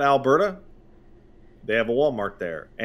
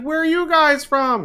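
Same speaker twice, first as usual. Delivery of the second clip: sweet lady; 14 semitones higher than usual. Retold or imitated. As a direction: coo at the dog like a sweet older lady. The lady asks.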